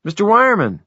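high, loud urgent call; agitated call. Acted. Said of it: "She sounded agitated".

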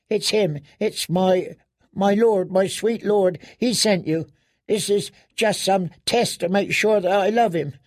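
put-on high, frightened girl's voice; scared girl insisting on her devotion; terrified pleading. A narrator's reading. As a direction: plead desperately, tripping over the words. Terrified girl pleads, fearing a trick.

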